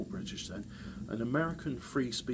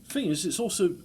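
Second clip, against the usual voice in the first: normal talking voice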